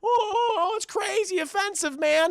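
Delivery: high-pitched flustered cartoon slacker; Shaggy. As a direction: report the scare in a goofy sidekick voice. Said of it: "and Shaggy's like".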